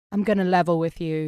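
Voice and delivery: lightly imitated British guy; retold voice note in a British accent. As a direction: put on a British man's smooth accent. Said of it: "hear my British accent".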